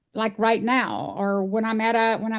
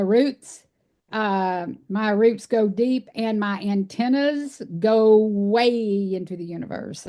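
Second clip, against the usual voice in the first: ordinary talk, nothing marked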